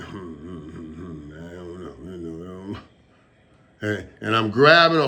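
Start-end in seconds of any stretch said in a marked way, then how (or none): 0.0-2.8 low, much softer hmms of doubt; undecided muttering. Retold or imitated. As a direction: mull it over under your breath. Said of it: "I'm looking at the Cowboys and saying".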